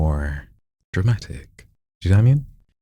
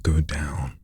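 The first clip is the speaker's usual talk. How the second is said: low and soft; 3 semitones lower, 6 dB quieter than usual. Shown as a demonstration. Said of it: "Go up".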